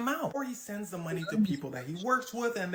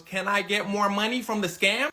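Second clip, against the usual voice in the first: raised-voice question; loud repeat; 2 semitones higher, 7 dB louder than usual. Retold or imitated. Partner repeating himself after "What?", loud and plain-spoken. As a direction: say it out loud for someone who missed it.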